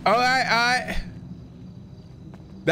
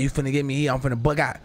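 bug out; 5 semitones higher than usual. Shown as a demonstration. Showing how to build to bugging out, rising threat. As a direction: start cool then snap into menace.

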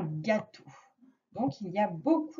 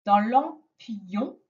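1 slow word stress; much slower than usual. Shown as a demonstration. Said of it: "On entend le ye".

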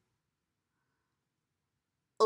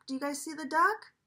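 usual speaking voice

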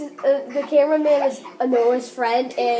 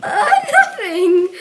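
high, drawn-out innocent protest; playful guilty denial; 7 semitones higher, 5 dB louder, slower than usual. Pretend. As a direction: deny it loudly, stretching it out. Guilty denial to teasing question.

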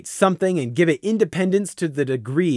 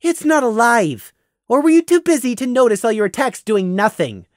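exasperated; 8 semitones higher than usual. A narrator's reading. Prey snapping at Gloom.

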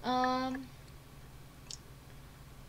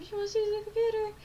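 high, fast and near tears; mock panic; 9 semitones higher, faster than usual. Teen in the game panics as mom walks in. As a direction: rush it out in a fake panic.

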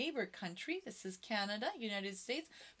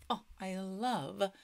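ordinary delivery

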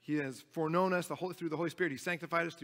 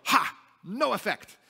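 loud, higher "Ha! No effect" barked back; barked taunt; 4 semitones higher, 6 dB louder than usual. Retold or imitated. Friend Abby barking back at teasing, loud. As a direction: bark it back like a smug friend. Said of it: "she would just bark this back".

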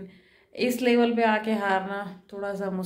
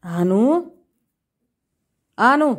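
louder, rising call of the name; 4 semitones higher, 6 dB louder, much slower than usual. Real, not acted. Calling crying son by name. (बेटे को ज़ोर से पुकारना)

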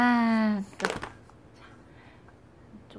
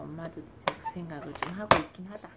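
normal talking voice

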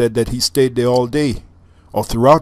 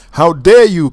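much higher, loud furious shout; angry shout; 7 semitones higher, 10 dB louder than usual. Acted. Canute shouts at the waves lapping his feet, furious. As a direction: roar it at the ocean in outrage. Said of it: "Canute shouted".

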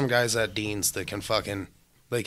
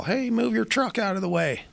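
higher, lightly voiced call; a hint of a worker calling out; imitating the loader operator; 12 semitones higher than usual.

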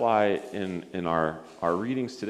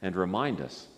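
lower, soft prayer lines for others to repeat; 4 semitones lower, 7 dB quieter, slower than usual. Acted. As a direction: lead a gentle prayer phrase by phrase. Leads prayer for repetition.

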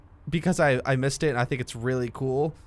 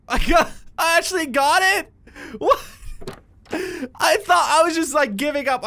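high, louder amazed exclaim; real surprise; 11 semitones higher, 4 dB louder than usual. Real, not acted. Cracking the lock code, a high amazed exclaim. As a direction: disbelief breaking into delight.

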